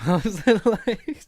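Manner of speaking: high, loud laugh through the words; cracking up mid-sentence. Real, not acted. Laughing at the quote.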